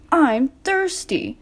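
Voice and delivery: mild whine; whiny. A narrator's reading. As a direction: whine it like a thirsty child. Thomas complaining he's thirsty.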